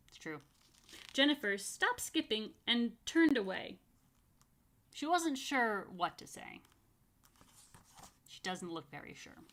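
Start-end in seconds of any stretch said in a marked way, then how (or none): none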